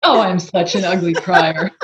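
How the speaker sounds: ordinary talk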